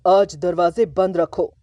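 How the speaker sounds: father issuing a household order, commanding. A narrator's reading. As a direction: lay down the law. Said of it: "उन्होंने हुक्म दिया".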